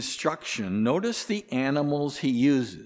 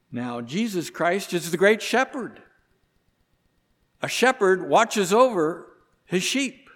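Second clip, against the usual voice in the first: rising, louder sermon voice as he voices Jesus; preaching at full force; 4 semitones higher, 5 dB louder than usual. Acted. Preacher warning the congregation about the wolves, fervent and loud. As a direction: drive the point home to the congregation.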